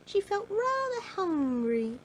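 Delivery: high, slow greedy relish; high, slow voice full of greedy relish; hungry little voice. A narrator's reading. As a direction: savour the hunger in a squeaky voice. Narrator voices greedy hunger.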